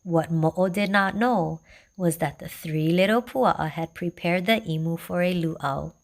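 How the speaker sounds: ordinary delivery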